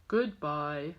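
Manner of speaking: low, deep goodbye; low dog goodbye. Acted. Grandma voicing the other dog, low goodbye.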